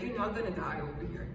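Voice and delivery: ordinary talk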